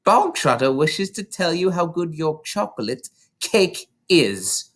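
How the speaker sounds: loom over the cook with a booming sneer; menacing headmistress calling for the cook; Trunchbull. Acted. Trunchbull voiced in a story.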